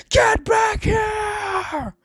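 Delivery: long, drawn-out high-pitched scream; screaming at top of lungs. A narrator's reading. Villain screaming after the escaping rescuers, drawn-out furious scream. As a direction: scream it out, stretching every word. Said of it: "would scream at the top of his lungs".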